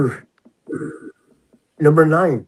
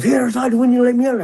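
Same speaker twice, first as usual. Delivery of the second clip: higher, casual inmate voice; inmate asking; 8 semitones higher than usual. Retold or imitated. Inmate asks officer when he gets out, casual.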